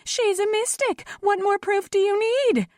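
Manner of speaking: very high, piercing accusation; shrill it out over a crowd; shrill outcry. A narrator's reading. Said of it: "a woman shrilled out".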